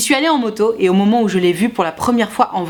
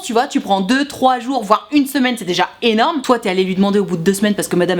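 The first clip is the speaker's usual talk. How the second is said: normal talking voice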